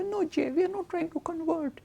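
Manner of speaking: high, lightly voiced gentle minister; cozy minister.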